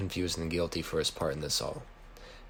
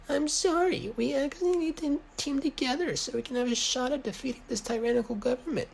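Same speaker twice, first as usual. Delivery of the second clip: Riley's voice. A narrator's reading. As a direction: squeak it out way up high like a nervous kid. Riley voiced in story dialogue.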